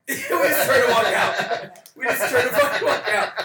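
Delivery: laughing about fleeing the bar. Real, not acted. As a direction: laugh through the line. Laughing retelling walking out.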